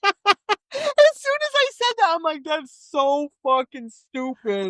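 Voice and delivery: squealing through laughter, high; high, slow, helpless giggly delivery; laughing. Real, not acted. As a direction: barely get it out for laughing. Laughing at her own line.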